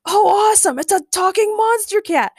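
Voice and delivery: high, loud, thrilled squeal; happy shout. A narrator's reading. Ray spots a talking cat, shouting happily. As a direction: shout in high-pitched delight. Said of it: "Ray shouted happily".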